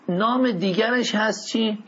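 ordinary delivery

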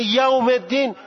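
Persian-accented یوم الدین; non-Arabic accent. Shown as a demonstration. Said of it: "اصلاً لهجهٔ عربی نیست".

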